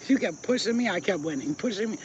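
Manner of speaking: normal talking voice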